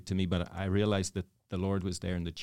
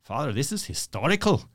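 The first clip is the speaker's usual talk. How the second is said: high, swooping Northern Irish lilt, getting louder; Northern Irish accent; 7 semitones higher, 7 dB louder than usual. Retold or imitated. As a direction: imitate a fervent Northern Irish neighbor. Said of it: "he's from the Northern Ireland".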